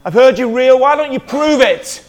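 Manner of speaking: loud, high desperate plea, slowing at the end; a mock cry to heaven, pleading him to prove himself; retold woman crying out to God in anguish, loud and high. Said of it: "I cried out".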